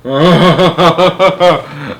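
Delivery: long, rippling real laugh; amused laugh. Real, not acted. Reader laughs before going on.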